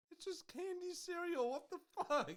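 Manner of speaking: high-pitched appalled exclamation; incredulous outburst. Real, not acted. Taster complains to friends about candy cereal, incredulous.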